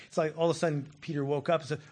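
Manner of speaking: ordinary talk, nothing marked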